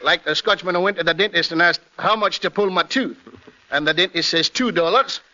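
comic Scotchman's accent; Scottish accent. Shown as a demonstration. Actor telling a dentist joke, Scottish accent. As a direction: tell a joke in Scots burr. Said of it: "I can play the Scotchman".

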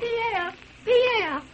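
high urgent call across the house; calling out upset. Acted. Wife calling out to Pierre in distress. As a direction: call for her husband, distraught.

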